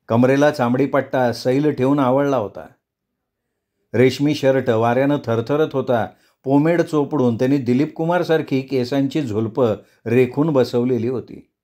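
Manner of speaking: ordinary delivery, nothing marked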